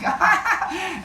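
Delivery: high, laughing squeal; giggling cry. Acted. Teacher inwardly giggling at the bitten man, high laughing squeal. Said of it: "I just start giggling".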